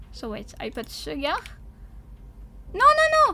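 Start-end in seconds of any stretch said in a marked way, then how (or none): 2.7-3.3 high, loud quick outburst; alarmed protest. Real, not acted. Streamer catching a recipe mistake in the game, alarmed. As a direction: cry out to stop a mistake.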